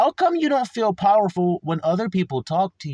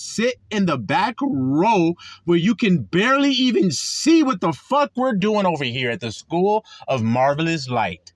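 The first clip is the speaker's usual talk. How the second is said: normal talking voice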